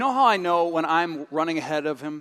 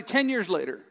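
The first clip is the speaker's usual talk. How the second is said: usual speaking voice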